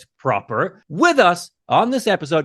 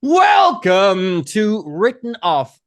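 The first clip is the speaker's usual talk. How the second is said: loud, drawn-out podcast welcome; big slow announcer welcome; show-opening shout; 4 dB louder, slower than usual. Real, not acted. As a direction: belt out the show's name, stretching the words. Host calls out show's opening.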